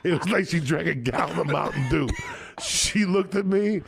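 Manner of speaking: ordinary talk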